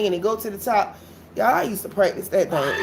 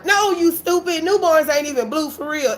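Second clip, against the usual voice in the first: higher and louder, fed-up snap; exasperated retort; 7 semitones higher, 3 dB louder than usual. Retold or imitated. Mocking mama's newborn excuse, a high, loud fed-up retort. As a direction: shoot it back like she's being ridiculous. Snapping back at her mother.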